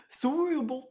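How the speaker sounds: high-pitched, botched Canadian accent; hammed-up, puppet-like mock Canadian; bad Canadian accent. Shown as a demonstration. Co-host attempting the accent, bad high Canadian. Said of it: "Bad at accent".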